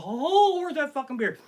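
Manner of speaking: high, loud mock wail; wailing rival comic. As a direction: whine and howl as the duped rival. Acted rival hunting for beer.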